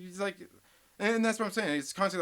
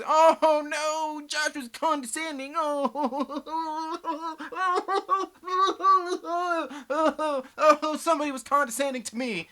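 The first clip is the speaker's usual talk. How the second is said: mocking laugh; 6 semitones higher than usual. Real, not acted. Mocking Josh's complaint, laughing.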